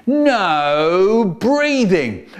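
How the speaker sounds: slow loud drawn-out command; teacher ordering the class not to breathe; stern teacher. Retold or imitated.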